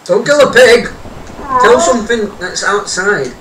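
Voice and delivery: shouting. Real, not acted. Friend about to kill pig.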